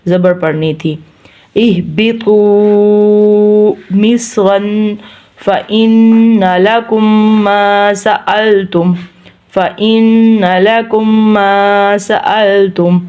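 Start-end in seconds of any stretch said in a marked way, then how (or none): 1.6-13.0 slow, humming the held n in "فإن"; ghunna recitation. Shown as a demonstration. Teacher modelling ghunna, slow nasalised recitation. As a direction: draw out long vowels and nasal n of "فإن لكم". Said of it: "ہم نے گننا کیا ہے".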